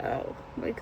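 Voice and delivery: unvoiced whisper of Beau; hushed quote. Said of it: "he goes out there and he's like".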